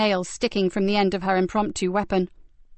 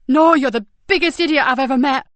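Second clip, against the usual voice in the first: loud tearful exasperation; 5 semitones higher, 7 dB louder than usual. Acted. Nicky exasperated through tears.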